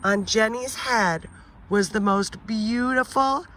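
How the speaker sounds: usual speaking voice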